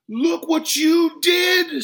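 very high, slow, loud scream; drawn-out enraged yell; furious scream. A narrator's reading. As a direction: scream the accusation, stretching each word. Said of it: "screamed the customer".

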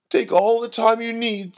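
high, mildly sobbing voice; a high-pitched wail held between sobs; tearful. A narrator's reading. Myrtle consoling Doreen over a supposed death, tearful. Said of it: "said Myrtle between sobs".

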